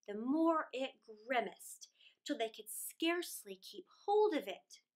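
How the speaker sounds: usual speaking voice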